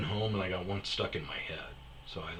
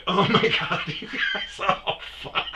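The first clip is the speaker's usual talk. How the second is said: laughing in embarrassment; 13 semitones higher, 6 dB louder than usual. Retold or imitated. Friend's band heard the memo.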